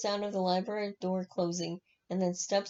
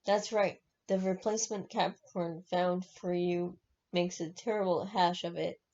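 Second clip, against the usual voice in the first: ordinary delivery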